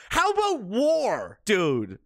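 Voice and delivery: high, slow sarcastic retort; slow high scoff; scornful. Real, not acted. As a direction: drag it out mockingly.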